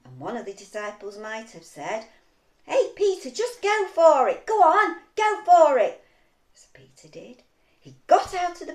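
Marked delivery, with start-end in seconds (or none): 2.6-6.0 loud, high, rousing encouragement; egging on. Acted. Disciple urging Peter out of the boat, loud egging on. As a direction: cheer him on with a raised voice.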